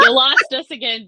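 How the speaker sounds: laughing loud no, high and bright; laughing protest. Real, not acted. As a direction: laugh through the complaint.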